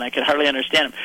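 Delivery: ordinary delivery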